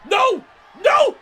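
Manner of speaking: high, loud pleading no; desperate screams. Real, not acted. Commentator to fans, screaming desperate no. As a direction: scream no in despair.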